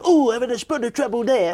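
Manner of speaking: high, hammed-up hapless character; old-style impression. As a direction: do a broad, high stage impression. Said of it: "how people used to do them".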